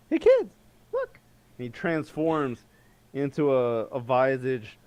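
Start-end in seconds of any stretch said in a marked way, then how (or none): 0.1-1.2 put-on much higher, calling to the kids; excited wizard. Little wizard shows kids a trick, high and eager. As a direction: show off to the children. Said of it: "He's like".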